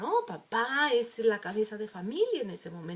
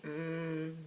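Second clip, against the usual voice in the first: reluctant grunt; 6 semitones lower, 6 dB quieter than usual. Retold or imitated. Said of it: "hacen carita".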